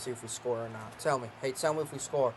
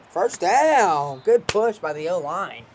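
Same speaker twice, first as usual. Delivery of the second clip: excited; 4 semitones higher, 9 dB louder, much slower than usual. Real, not acted. Cheering a gain.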